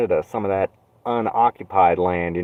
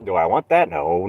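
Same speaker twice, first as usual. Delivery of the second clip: ordinary talk, nothing marked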